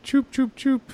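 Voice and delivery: high, slow choop sounds to a cat; kissy clicking noises for a cat; cat-calling clicks. Shown as a demonstration. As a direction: click your mouth to call the cat. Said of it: "I just go like".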